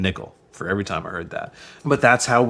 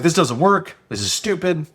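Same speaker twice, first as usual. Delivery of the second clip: retold frustration, pitched up; frustrated; 7 semitones higher than usual. Complaining to the guy about flyers, mildly frustrated. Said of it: "I was all frustrated and stuff like that".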